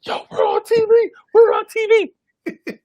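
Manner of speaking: very high, loud squeal of joy; giddy excitement. Retold or imitated. Friend Khalad losing it at the station, squealing with joy. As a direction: squeal like a kid going on TV.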